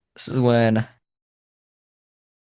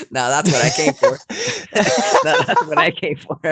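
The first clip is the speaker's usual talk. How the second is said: higher, laughing through the words; 7 semitones higher than usual. Real, not acted. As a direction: crack up at your own joke. Cracking up at own joke.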